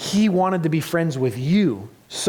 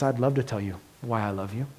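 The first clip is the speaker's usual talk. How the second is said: soft, low, loving reply; a quiet, tender voicing of Jesus; Jesus, tenderly; 5 semitones lower, 6 dB quieter, faster than usual. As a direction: answer like you'd hold them close. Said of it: "He says".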